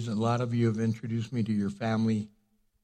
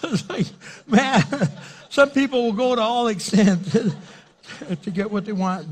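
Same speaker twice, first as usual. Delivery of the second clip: laughing hard and much higher; real laughter, louder; 11 semitones higher, 6 dB louder than usual. Real, not acted. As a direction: talk through your own laughter. Laughing after the punchline.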